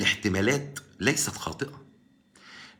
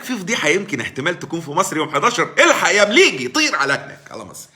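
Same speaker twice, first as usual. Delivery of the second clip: laughing, louder hurry to get the story; mock eager reporter; 6 semitones higher, 7 dB louder than usual. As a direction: ham up a newsroom boss sending a cameraman. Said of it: "قال لك". (تقليد ساخر لمحرر متحمس يضحك)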